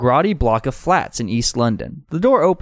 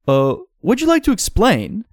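moderately high character voice; wrong character's voice; 7 semitones higher than usual. Acted. Asking visitors for an explanation, moderately high character voice. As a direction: ask it in a raised put-on voice. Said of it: "no, that's the other guy".

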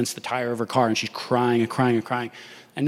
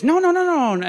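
pitched-up frantic no no no; high protest; 14 semitones higher, 4 dB louder than usual. Retold or imitated. Woman refusing the cash, high frantic protest.

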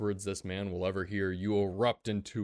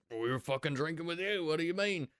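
higher, indignant guard voice; protesting guard; 8 semitones higher than usual. Acted. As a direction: defend yourself, baffled. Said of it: "As they're like".

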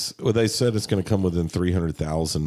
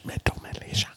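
whisper; 7 dB quieter than usual. Pretend. Echoed whisper of title.

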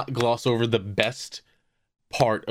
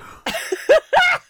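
high-pitched, loud laugh; burst of laughter; 5 dB louder than usual. Real, not acted.

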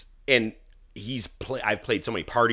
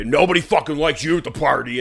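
raised, higher mock drunk tirade; drunken yelling; 6 semitones higher, 7 dB louder than usual. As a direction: imitate a drunk husband yelling. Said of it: "he's yelling at his wife".

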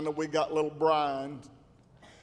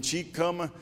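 ordinary talk, nothing marked

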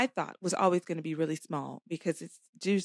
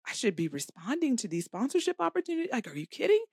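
higher, quick-running objection; incredulous protest; 5 semitones higher, faster than usual. Retold or imitated. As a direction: rush it out in retold disbelief. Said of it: "And I was like".

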